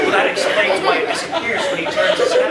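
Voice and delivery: high, loud, laughing realisation; mother suddenly getting it, laughing; dawning mom. Retold or imitated. Mother finally understanding the chin shadow, high and amused.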